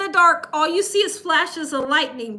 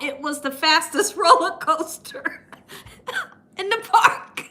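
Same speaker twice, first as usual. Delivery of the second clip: real giggles overtaking the words; laughing while talking; 2 semitones higher than usual. Real, not acted. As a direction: break into laughter mid-sentence. Cracks up telling the punchline.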